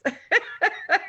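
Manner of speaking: high, delighted little laugh; real laugh. Real, not acted. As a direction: laugh with pleased surprise.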